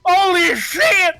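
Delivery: high, slow disbelieving yell; ecstatic shout. Real, not acted. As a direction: scream it in disbelief. Players celebrating a win.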